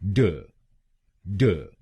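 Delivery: ड said short and light, a bare retroflex da; ड given as a brief unstressed syllable; quick middle syllable. Shown as a demonstration. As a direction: clip ड very short. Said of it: "दूसरे शब्दांश को यानी डीयू हम बोलेंगे".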